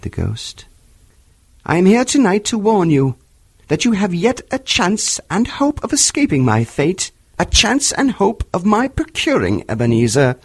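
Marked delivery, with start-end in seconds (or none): 1.6-10.4 a tormented spirit crying out; unearthly voice. A narrator's reading. Marley's ghost warns.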